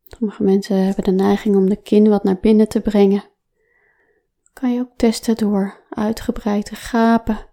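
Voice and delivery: normal talking voice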